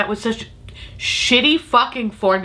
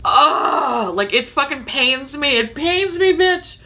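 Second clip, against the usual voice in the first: mock anguish; 8 semitones higher, slower than usual. Real, not acted. Pained by the price.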